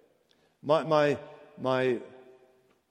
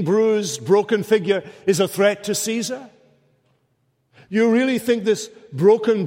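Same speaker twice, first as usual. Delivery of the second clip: loud, higher, scornful disbelief; incredulous mocking challenge; 6 semitones higher, 5 dB louder than usual. Pilate mocking the crowd's charge, loud and scornful. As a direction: throw the question back in scorn.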